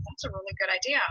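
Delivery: soft breathy whisper, barely voiced; whispered thought. Retelling his own inner thought.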